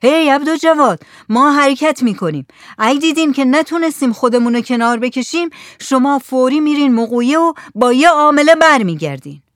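shouting across water. A narrator's reading. Helmsman shouts orders to the men in the rowboat, loud call. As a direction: shout it out to the rowers far away. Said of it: "با صدای بلند داد زد".

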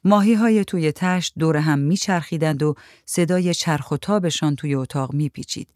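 plain reading voice

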